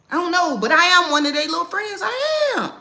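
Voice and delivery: high, loud exclamation; excited shout. Real, not acted. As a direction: insist excitedly. Exclaiming she is a friend.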